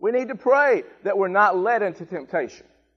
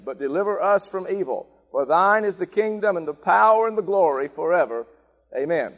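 prayer recitation; 4 semitones lower than usual. Real, not acted. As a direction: recite it as worship, steady and reverent.

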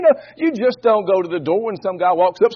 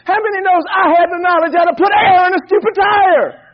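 preaching at full force; 6 semitones higher than usual. Acted. Pastor driving home his marriage point to the church, full-force preaching. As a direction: drive the point home to the congregation.

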